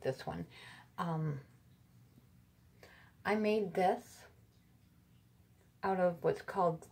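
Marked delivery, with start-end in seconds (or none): none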